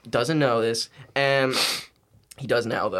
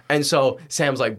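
ordinary talk

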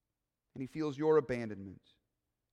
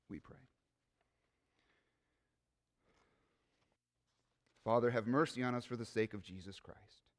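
prayer; 2 semitones lower, 4 dB quieter than usual. Real, not acted. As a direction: pray quietly and reverently. Closing prayer.

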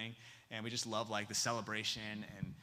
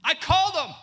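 high, loud burst of news; excited announcement; 13 dB louder than usual. Retold or imitated. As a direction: blurt it out, thrilled, as the changed student. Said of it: "her countenance has completely changed".

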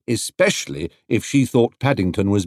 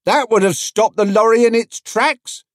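higher, louder crowd voice; a voice from the back, pitched up; someone in the crowd; 13 semitones higher, 4 dB louder than usual. A narrator's reading. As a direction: crowd member agreeing, higher. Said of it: "agreed someone else".

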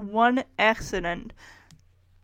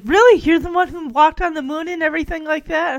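higher-pitched, starstruck gushing, starting loud; imitated excitement; 4 semitones higher, 6 dB louder than usual. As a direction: gush as if meeting your hero. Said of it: "Betty is so excited".

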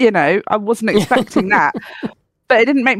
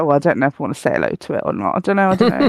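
lower, quick, unsure voicing of the dog; sheepish dog voice; 5 semitones lower, much faster than usual. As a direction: give the timid dog a hesitant inner voice. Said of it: "Ivy looking a bit sheepish".

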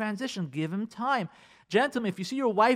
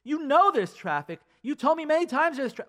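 higher and louder exasperated retort; annoyed wife; 10 semitones higher, 4 dB louder than usual. Retold or imitated. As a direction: play a wife snapping back in disbelief. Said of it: "she's gonna say to you".

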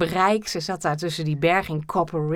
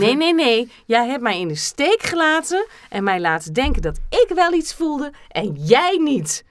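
higher and louder, hammed-up anger; mock furious; 6 semitones higher, 4 dB louder than usual. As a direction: scold him like a betrayed lover. Role-play quarrel, loud and high.

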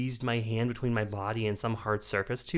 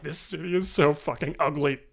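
put-on drunken disgust; 5 semitones higher, 5 dB louder than usual. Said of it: "And I'm like".

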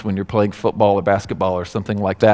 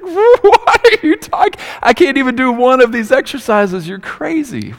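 incredulous outburst; 4 dB louder than usual. Acted. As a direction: react as if she asked the impossible. Reacting to impossible coach instructions.

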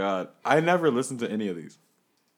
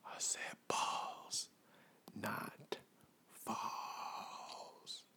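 whisper; 4 semitones lower, 17 dB quieter, much slower than usual. Acted. Whispered correction.

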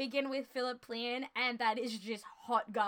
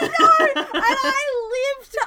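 laughing; 12 semitones higher, 12 dB louder, slower than usual. Real, not acted. Still laughing after the bit.

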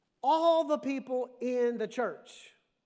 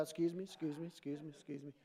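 soft, polite excuse me, lower and much softer; polite murmur; 8 semitones lower, 13 dB quieter, faster than usual. Retold or imitated. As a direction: slip past murmuring apologies. Jesus slipping through crowd.

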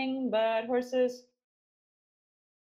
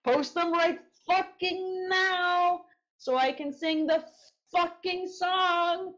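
slow, stretched-out demand; drawn out; 7 semitones higher, slower than usual. Acted. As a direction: stretch each word out. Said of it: "drawn out for emphasis".

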